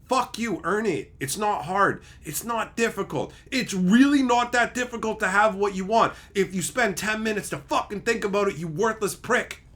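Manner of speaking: indignant tirade; angry rant. Acted. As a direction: tell off a lazy writer, worked up. Rant at lazy writers.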